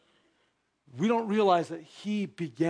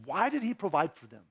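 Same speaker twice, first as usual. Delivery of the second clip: ordinary delivery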